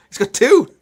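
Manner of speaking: high, loud delighted squeal; gleeful surprise. Real, not acted. Co-host returns with two beers.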